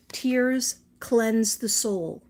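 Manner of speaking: slow, emphatic reading; card read-out. Real, not acted. Showing viewers the card she got, slow emphatic read-out. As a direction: draw out each word of the message. Reads the same card out again.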